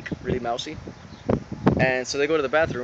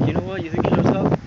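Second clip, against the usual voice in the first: loud, higher retold challenge; mock upset; 5 semitones higher, 7 dB louder than usual. Upset boy challenging the bully, loud mock outrage. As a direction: imitate an upset boy squaring up loudly. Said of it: "Joey gets upset".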